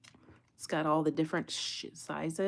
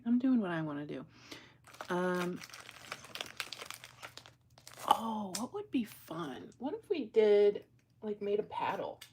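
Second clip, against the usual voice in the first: normal talking voice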